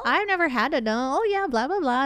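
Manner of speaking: sing-song Midwestern chatter, climbing high; mock a chatty Midwesterner; imitated Midwest accent. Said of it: "it was so Midwest. It was so like".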